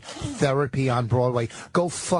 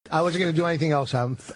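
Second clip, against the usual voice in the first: ordinary talk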